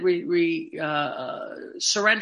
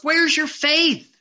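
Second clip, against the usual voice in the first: high, slow, loud rebuke; stern teacher demanding an answer; upset Jesus; 8 semitones higher, 4 dB louder, slower than usual. Acted. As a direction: scold the disciple for doubting. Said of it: "He was upset".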